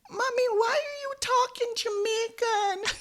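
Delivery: high-pitched little kid voice, imitated; small child. Small kids question their angry mommy's accent, imitated high child voice. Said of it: "when they were small, they would say".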